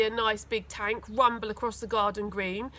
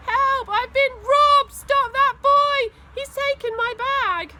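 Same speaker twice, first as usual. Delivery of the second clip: put-on much higher, much louder, slow shrieking old lady; old lady shrieking for help; 14 semitones higher, 9 dB louder, slower than usual. A narrator's reading. Robbed old lady shrieking at the market crowd, much higher and louder.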